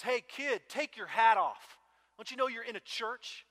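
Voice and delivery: put-on loud, stern rebuke from an old church member; stern scolding. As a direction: bark at the kid about his hat. Stern old man scolding kid.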